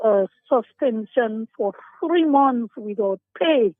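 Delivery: normal talking voice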